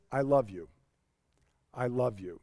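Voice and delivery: very low, soft, sleepy-quiet endearment; tender murmur. Retold or imitated. Spouse waking in the middle of the night, tender murmur.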